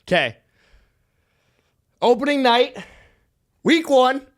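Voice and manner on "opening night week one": high, louder hype-man build-up; hype announcer. Acted. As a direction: announce it like a big reveal. Hyping up his lineup reveal.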